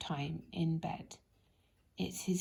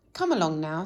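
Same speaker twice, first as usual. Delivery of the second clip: louder, bustling hurry-up; busily; 2 semitones lower, 7 dB louder than usual. Acted. Mister Bustle hurrying Mister Lazy, busy and loud. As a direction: chivvy him along briskly. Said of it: "said Mister Bustle busily".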